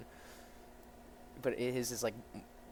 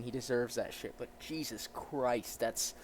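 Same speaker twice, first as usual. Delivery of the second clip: ordinary delivery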